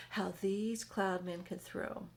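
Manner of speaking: usual speaking voice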